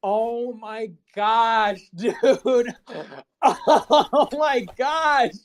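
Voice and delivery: slow, high, breaking into laughter; laughing so hard the words stretch out; helpless laughter. Real, not acted. Streamer watching the clip, crying with laughter.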